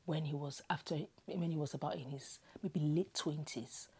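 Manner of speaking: ordinary talk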